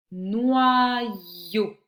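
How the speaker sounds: loud, long-held "Noyau"; slow, projected syllable demo of "Noyau". Shown as a demonstration. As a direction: stretch both syllables of "Noyau" for the listener. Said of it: "dans quelle syllabe tu entends".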